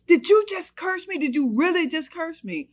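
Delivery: high, loud disbelieving scream. Retold or imitated. Wife screaming at cursing husband, high loud scream. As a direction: scream it in outrage. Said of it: "I was screaming".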